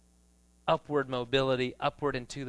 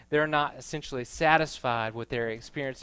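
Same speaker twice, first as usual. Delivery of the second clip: usual speaking voice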